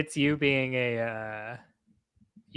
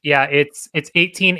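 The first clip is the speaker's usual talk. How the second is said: excited; 3 semitones higher, 10 dB louder than usual. Real, not acted. Reading scores excitedly.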